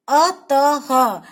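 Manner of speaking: अतः stretched very slowly with a breathy ह at the end; visarga demo. Shown as a demonstration. Teacher drags अतः out so children hear the ह. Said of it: "इसको हम ह बोलते हैं".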